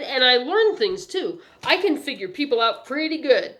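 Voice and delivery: put-on businesslike young paper seller; eager newsboy. A narrator's reading. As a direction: brag about the job like a keen kid. Newsboy boasting.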